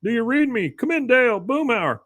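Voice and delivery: high-pitched plaintive radio call; Bill. Retold or imitated. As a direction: imitate a man calling his friends over a handheld radio. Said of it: "over the little radio ... you hear".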